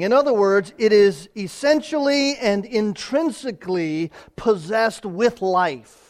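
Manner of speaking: high and loud, pressing the point home; preaching at full force. Acted. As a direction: proclaim it to the whole church.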